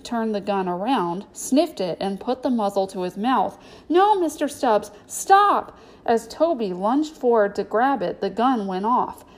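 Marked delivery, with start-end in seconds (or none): 3.9-5.8 urgent, high cry of warning; alarmed shout. A narrator's reading. Boy to chimp with gun at its mouth, alarmed shout. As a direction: shout it to stop a disaster.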